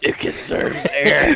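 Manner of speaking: slow, voiceless scary rasp; mock Batman voice. Friend imitating his buddy's excuse for the voice, breathless Batman rasp. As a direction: squeeze out each word like a winded Batman, for laughs. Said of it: "Why are you talking like that?".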